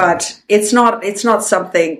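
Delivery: ordinary talk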